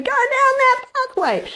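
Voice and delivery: feelings urging. Retold or imitated. The body's feelings urging her back down the old pathway, high and coaxing. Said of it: "That's what your feelings will be saying".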